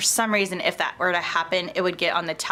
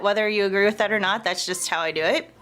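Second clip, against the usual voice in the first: usual speaking voice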